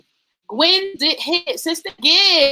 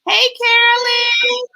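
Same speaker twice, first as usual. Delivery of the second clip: high, drawn-out singsong hello; gushing high hey; excited greeting; 10 semitones higher, 3 dB louder than usual. Real, not acted. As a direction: greet her slowly, pitched way up. Greeting viewers from the live comments.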